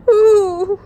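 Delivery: loud, high frightened ooh; scared ooh. Acted. Miss Nelson's kids hearing about the meanest substitute, frightened high ooh.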